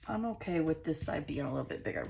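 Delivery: normal talking voice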